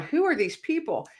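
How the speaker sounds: high-pitched retold bewilderment; puzzled, pitch jumping up; baffled. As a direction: ask it in mock astonishment. Retold bafflement at strangers' messages.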